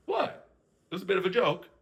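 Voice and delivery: high, airy mock Lorenzo shrugging it off. Podcaster imitating Lorenzo brushing off Julia's upset, lightly mocking. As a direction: toss it off like it's nothing.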